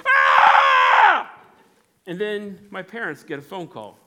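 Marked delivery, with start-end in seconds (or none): 0.1-1.4 high, very loud shriek; amygdala scream. Acted. Amygdala shrieking as the boy climbs, loud panicked scream. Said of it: "the amygdala goes".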